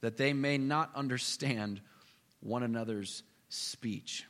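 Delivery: low, slow and grave; a low solemn divine pronouncement; solemn voice of God. Retold or imitated. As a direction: read it as God's decree, low and deliberate. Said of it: "God says".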